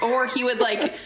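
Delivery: ordinary talk, nothing marked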